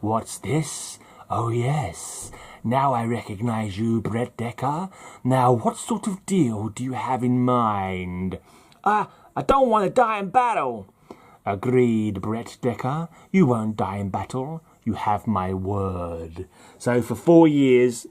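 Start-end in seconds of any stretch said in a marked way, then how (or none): 0.0-8.4 soft, measured voice of Death; hushed Grim Reaper weighing a deal. Acted. Voices Death answering the soldier. 8.8-10.9 high, hammed-up Southern accent; badly faked Southern soldier, pitched way up; worst Southern accent. Soldier answers Death in same voice. 11.5-16.5 low, soft, drawn-out Death; quiet, slow voice of doom. Acted. Voices Death agreeing to the deal.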